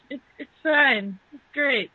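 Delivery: normal talking voice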